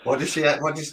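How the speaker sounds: ordinary delivery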